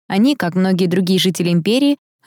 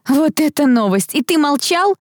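much higher, incredulous exclamation; delighted astonishment; 10 semitones higher than usual. A narrator's reading. Sister learning her brother kept his engagement secret, amazed. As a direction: climb high into an amazed question. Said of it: "ахнула я".

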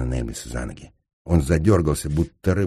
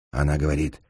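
ordinary delivery

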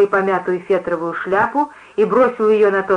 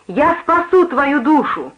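high, slow, mildly frantic promise; frantic cry; 6 semitones higher, slower than usual. A narrator's reading. Preacher promising to save the narrator, frantic cry. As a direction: stretch it out in a high pitch, lightly frenzied. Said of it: "пообещала она мне истошным голосом".

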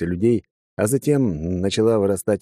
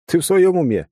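raised, higher-pitched indignant urging; exclaiming; 7 semitones higher, 3 dB louder than usual. A narrator's reading. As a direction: exclaim it like a rallying hero. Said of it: "воскликнул я".

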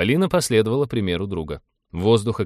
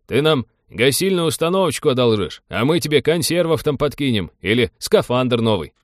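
raised, higher haggling voice; calling out an offer; 5 semitones higher, 5 dB louder than usual. A narrator's reading. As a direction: call a trade proposal across to the guard. Envoy calls out a trade offer.

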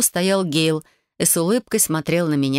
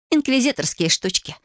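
higher, breezy brush-off; casually dismissive, pitched up; carefree dismissal; 7 semitones higher than usual. A narrator's reading. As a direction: wave it away lightly. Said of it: "беззаботно отмахнулась Ви".

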